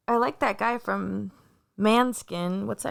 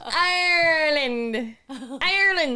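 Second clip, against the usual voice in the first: strained Irish Ireland; 3 semitones higher than usual. Acted. Stretching out "Ireland" for a friend, in a forced Irish accent. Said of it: "then say Ireland".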